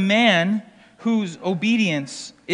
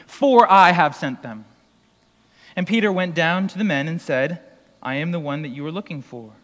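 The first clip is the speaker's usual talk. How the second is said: steady lower public reading; scripture reading; 3 semitones lower than usual. Acted. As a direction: read the passage plainly and lower.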